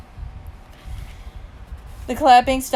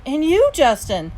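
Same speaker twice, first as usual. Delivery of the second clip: louder, higher, hearty congratulations; enthusiastic; 6 semitones higher, 6 dB louder than usual. A narrator's reading. Dad congratulating Justin backstage, enthusiastic. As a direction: turn the praise onto someone new.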